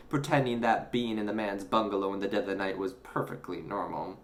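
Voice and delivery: ordinary delivery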